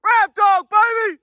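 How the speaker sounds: high-pitched full-force yell; screaming for echo. Real, not acted. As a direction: holler it out for the echo. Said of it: "whatever the fuck I was screaming".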